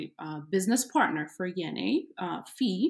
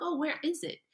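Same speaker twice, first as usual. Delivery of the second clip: puzzled question; 11 semitones higher than usual. Retold or imitated. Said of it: "and they're like".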